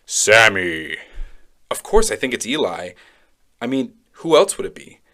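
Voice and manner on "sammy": low, drawn-out deep calling voice. Acted. Deep voice calls the sleeping child by name, deep and low. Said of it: "hear this deep voice say my name".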